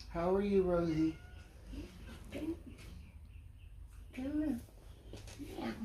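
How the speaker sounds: high pet-talk calling Rosie; cat talk. Real, not acted. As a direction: call the cat over sweetly.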